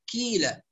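"كيلة" with a front k in place of q; over-lighten the q until it becomes k in "كيلة"; qaaf turned kaaf. Shown as a demonstration. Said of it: "تتحول إلى كاف". ("كيلة" بكاف بدل القاف)

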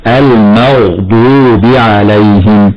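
slow "المغضوب" with thick emphatic غ; heavy ghayn. Shown as a demonstration. Sheikh demonstrating tafkheem, "المغضوب" with heavy غ. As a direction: thicken the غ in "المغضوب", reciting slowly. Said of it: "من حروف التفخيم وتفخم".

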